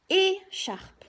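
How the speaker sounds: "écharpe" with a high, loud É, then charpe; stress on first syllable. Shown as a demonstration. Teacher helping a child hear é, "écharpe" as É... charpe. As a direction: punch the É of "écharpe" and detach it. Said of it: "Bien insister sur la première syllabe".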